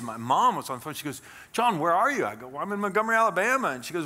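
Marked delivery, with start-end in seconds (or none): 1.5-2.3 worried mom calling. Retold or imitated. Mom calling son on phone. 2.5-3.7 casual retold reply.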